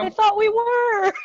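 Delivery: high, drawn-out comic whine; mock protest. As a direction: protest playfully, stretching it high and slow. Teasing protest at co-host.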